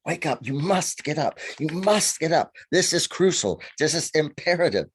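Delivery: high, pressing wake-up call; urgent insistent. Retold or imitated. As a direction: insist he must get up. Fairy waking him at night.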